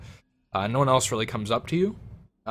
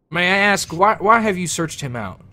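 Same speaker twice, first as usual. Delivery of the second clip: higher, louder probing question; suspicious captain; 7 semitones higher, 6 dB louder than usual. Acted. Captain questions the dwarf's purpose, higher and louder. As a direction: press him on his motives.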